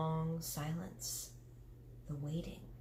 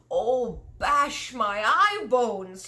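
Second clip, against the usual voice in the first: much louder, slow giant wail; distressed cry; 5 semitones higher, 9 dB louder, much slower than usual. Acted. Distressed giant waving the jar, loud wail. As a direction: howl out his distress. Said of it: "he cried".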